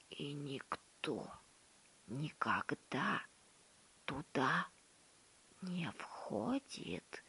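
slow hushed whisper. A narrator's reading. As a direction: breathe it like a secret. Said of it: "понизив голос до шёпота".

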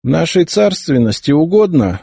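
high, scornful royal proclamation; sneering imperial decree; contemptuous. A narrator's reading. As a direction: look down on him as you speak. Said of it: "презрительно посмотрел на него племянник".

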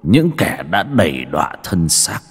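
measured, grim villain's indictment; menacing accusation. A narrator's reading. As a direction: accuse them in weighted, separate phrases. Avenger accuses the old men.

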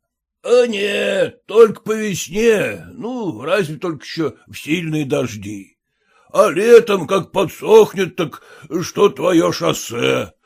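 lightly voiced, folksy driver; a hint of a slow-talking peasant. A narrator's reading. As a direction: explain with drawn-out words, like a rustic coachman.

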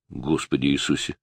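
hushed, low exclamation; whispered. A narrator's reading. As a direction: say it under your breath, still voiced. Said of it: "прошептал парень".

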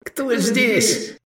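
very high, startled yell; high-pitched holler. A narrator's reading. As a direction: yell the challenge, voice way up. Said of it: "проорал Даврон".

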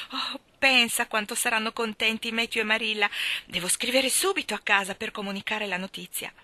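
ordinary delivery

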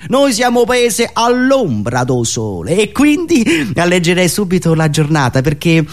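ordinary talk, nothing marked

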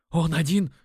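strained, broken by a gulp for air; gasping rasp. A narrator's reading. Punched dealer catching his breath, rasping a confession. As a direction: choke it out in two winded bursts. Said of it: "прохрипел он, хватая ртом воздух".

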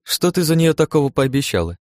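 teasingly curious, voice lifted; amused question. A narrator's reading. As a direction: ask with a touch of a smirk. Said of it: "усмехнувшись, спросила Ирен".

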